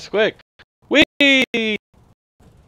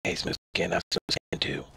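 whispered rasping ghoul voice; breathy hiss, like a sore throat; 9 semitones lower, 11 dB quieter than usual. Acted. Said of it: "It's not that hard to actually do a ghoul voice".

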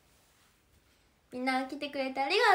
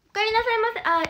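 sugary maid cafe greeting; 7 semitones higher, 7 dB louder than usual. Acted. Maid stream greeting viewers.

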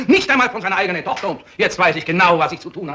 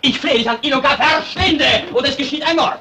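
frantic plea; 9 semitones higher, 4 dB louder than usual. Acted. Pleading, threatening murder.

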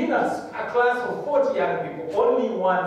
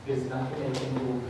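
weary resignation; 3 semitones lower, 8 dB quieter than usual. Retold or imitated. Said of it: "he said".

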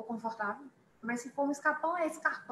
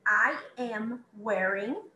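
I am wearing drawn out very slowly; very slow model; much slower than usual. Shown as a demonstration. Teacher repeats I am wearing very slowly for students. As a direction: stretch every word. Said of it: "se eu for dizer".

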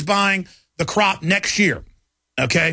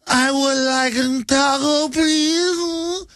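slow, high, hammed-up Mexican accent ordering food; mock Mexican accent; 9 semitones higher, much slower than usual. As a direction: drawl it out in a put-on Mexican accent, mocking. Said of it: "and they're like".

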